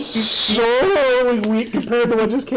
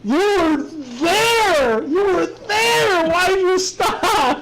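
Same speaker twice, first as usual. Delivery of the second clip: slow, higher, hammed-up crying plea; mock crying; 7 semitones higher, much slower than usual. Friend begs him to keep shouting, hammed-up crying. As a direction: wail it like a fake heartbroken fan.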